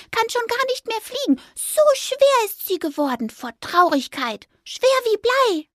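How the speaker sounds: high, reproachful mouse voice, slowing on schwer wie Blei; scolding mouse. A narrator's reading. Mouse reproaching the magpie for the stolen egg. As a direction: tell her off gently.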